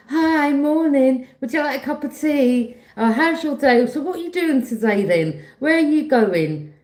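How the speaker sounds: high bright mock-friendly busybody; nosy neighbour. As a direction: chirp it like a nosy neighbour prying. Imitating a nosy fake-friendly neighbour.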